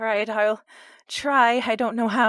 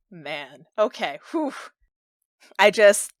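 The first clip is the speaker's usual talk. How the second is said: ordinary talk, nothing marked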